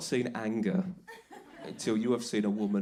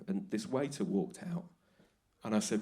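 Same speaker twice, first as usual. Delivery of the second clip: ordinary talk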